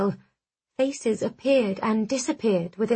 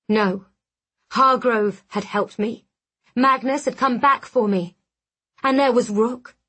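usual speaking voice

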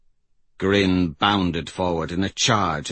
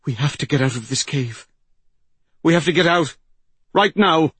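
urgent plea; 5 semitones higher, faster than usual. A narrator's reading. Old woman pulling mystic from the cave, increasingly urgent.

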